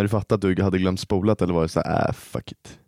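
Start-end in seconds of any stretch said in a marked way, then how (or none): 2.1-2.8 breathy whisper. Retold or imitated. Imagined careless reaction.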